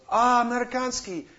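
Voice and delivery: put-on high, loud Russian-accented exclamation. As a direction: call out "Amerikanski" like a Russian villager. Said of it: "And he goes".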